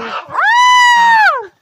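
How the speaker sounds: sudden high shriek of pain; a real startled scream. Real, not acted. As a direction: yelp like your foot just got stepped on. Startled, someone stepped on her.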